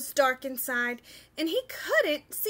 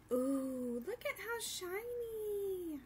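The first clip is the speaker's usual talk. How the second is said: hushed, slow and higher; soft aside; 4 semitones higher, 12 dB quieter, slower than usual. Real, not acted.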